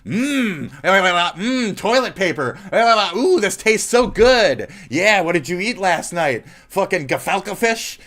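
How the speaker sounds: loud, high hammed-up moans of pleasure; over-the-top mock eating noises, loud and high; mock moaning while eating. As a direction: ham up a loud, high yummy moaning with nonsense la la la. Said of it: "I can say".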